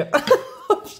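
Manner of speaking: high breathy burst of laughter; sudden giggling fit; laughing. Real, not acted. Laughing at own joke.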